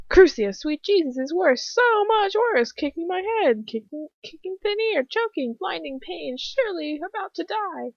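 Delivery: high-pitched stream of pain; agonised panic. A narrator's reading. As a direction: gasp out each thought in torment. Victim's thoughts under torture.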